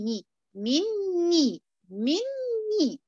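"مي، مني، مني" stretched far and rising high, noon held nasal; teacher models correct "مني" very slowly; very slow ghunna. Shown as a demonstration. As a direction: hold the doubled noon in "مني" long. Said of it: "لا، مي".

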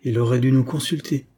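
plain reading voice

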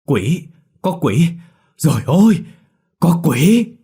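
high, loud panicked screams of ghost; terrified scream. Acted. Minh Thọt spotting a ghost by the buffalo pen, terrified shrieking. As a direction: shriek in sheer terror at the sight. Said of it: "thét lên kinh hãi".

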